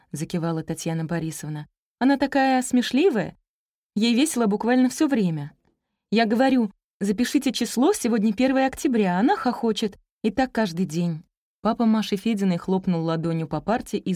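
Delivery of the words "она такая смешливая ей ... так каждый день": higher, animated complaint; eager young teacher, exasperated. Acted. Teacher telling a father about his giggly girl, animated. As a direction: recount it with mild helplessness.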